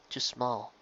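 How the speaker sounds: very soft, despairing echo; quiet echo. A narrator's reading. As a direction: repeat it to yourself, crushed. Self-doubt echo.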